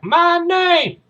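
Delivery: hard-core Marine bellowing his introduction; high, loud, slow drill-sergeant shout; Major Payne. Acted. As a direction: announce yourself slowly at full parade-ground volume. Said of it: "he's introducing himself".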